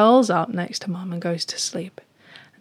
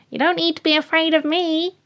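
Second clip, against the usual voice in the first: high-pitched little bat voice; 9 semitones higher than usual. Acted. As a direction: reassure as a tiny bat, pitched well up. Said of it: "this little bat says".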